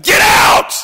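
sudden explosive shout; angry shout. Acted. Preacher acting Jesus driving out traders, furious shout. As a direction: erupt at full volume. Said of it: "He got angry".

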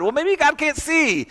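high, drawn-out mock reasoning; mock excuse. Preacher voicing a tempted person's excuse, high mock musing.

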